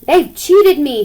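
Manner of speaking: mild mock howl. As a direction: howl it out in outrage. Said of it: "howled Tim".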